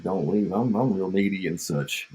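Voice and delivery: ordinary delivery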